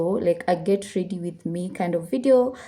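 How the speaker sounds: usual speaking voice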